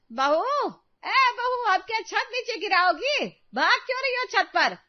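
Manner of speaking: very high-pitched yell upstairs; piercingly high mother-in-law telling off; scolding call. Acted. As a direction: holler at the daughter-in-law on the roof.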